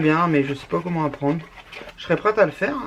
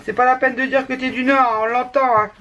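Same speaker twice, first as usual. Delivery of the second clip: higher-pitched mock of a teasing friend; 6 semitones higher, 4 dB louder than usual. Friend teasing her about her Nord accent, mock teasing. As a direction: rib someone about their accent, pitched up. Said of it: "J'ai des amis qui se foutent de moi".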